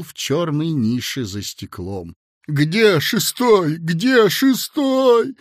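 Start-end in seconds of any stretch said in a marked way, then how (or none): 2.5-3.8 slow, louder lost call; weak cry. A narrator's reading. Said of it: "слабо крикнул он кому-то". 3.8-5.4 much higher, slow, louder; desperate cry. A narrator's reading. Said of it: "слабо крикнул он кому-то".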